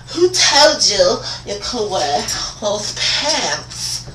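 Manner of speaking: high, drawn-out mock scolding; put-on administrator voice. Administrator challenging student about pants, slow high mocking voice. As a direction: draw the question out in a high, fussy voice.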